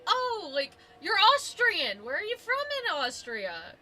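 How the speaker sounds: high, drawn-out excited stranger imitation; happily curious. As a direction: imitate eager interest in a foreigner. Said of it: "get really happy".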